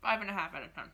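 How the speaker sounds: talking with mouth full; chewing while talking. Real, not acted. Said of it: "Sorry, I'm talking with my mouth full".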